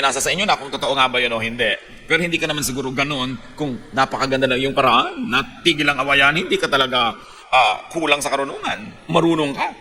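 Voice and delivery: ordinary talk, nothing marked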